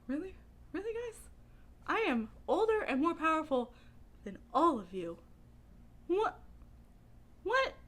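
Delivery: high, exasperated disbelief; indignant old wizard. Retold or imitated. Belgrath complaining about his companions, exasperated high indignation. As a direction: act put-upon and offended. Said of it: "he'd just be like".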